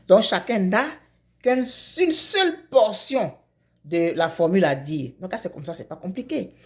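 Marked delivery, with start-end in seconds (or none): none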